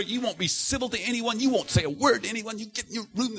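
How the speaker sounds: put-on high, stern scolding of the boys; dad ordering boys to their rooms. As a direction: send them to their rooms. Father sending sons to rooms.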